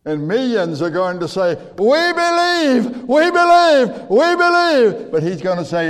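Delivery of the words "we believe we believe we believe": high, drawn-out wail of belief; wailing plea. Retold or imitated. Millions wailing to Jesus on judgment day, high slow anguished wail. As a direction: wail it like the lost begging too late. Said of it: "is going to start wailing".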